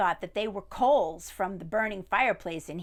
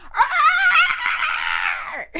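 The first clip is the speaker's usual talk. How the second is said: very high shrieking squawk; piercing rooster scream; rooster's scream; 12 dB louder than usual. Acted. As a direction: screech like a rooster. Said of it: "and he went".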